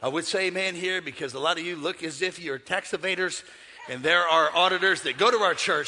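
ordinary talk

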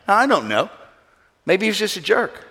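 usual speaking voice

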